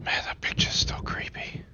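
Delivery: hushed creeped-out whisper; whispering that the picture is creepy. Real, not acted. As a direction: breathe it, spooked by the picture.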